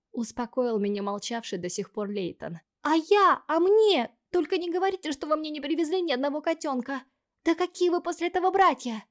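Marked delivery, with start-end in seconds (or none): 2.8-4.1 high, loud indignant squeal; emotional outburst. Acted. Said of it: "Эмоционально высказалась Вейти". 4.4-5.1 squealing high. Acted. Повтор фразы Вейти. 5.1-9.0 high, loud indignant squeal; emotional outburst. Acted. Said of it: "Эмоционально высказалась Вейти".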